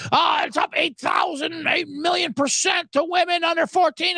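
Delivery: high-pitched, hammed-up excited announcement; mock hype. Number inventors touting [name] ratings, mock excitement.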